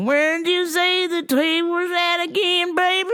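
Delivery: granny voice. Retold or imitated. Voicing Weed Granny again.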